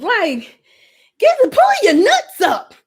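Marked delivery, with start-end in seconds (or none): 1.2-2.7 high, loud, bossy command; mock aggressive. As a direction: bark it at them, hammed up. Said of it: "my voice not even aggressive".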